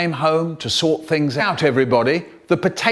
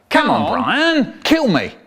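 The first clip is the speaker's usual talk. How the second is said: mock taunt; 9 semitones higher, 3 dB louder, slower than usual. Dad's taunt in the story.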